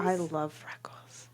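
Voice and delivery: whispering. Real, not acted. Confessing quietly.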